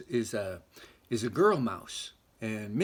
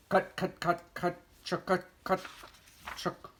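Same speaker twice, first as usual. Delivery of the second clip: high, clipped hen clucks; hen clucking; 4 semitones higher than usual. Acted.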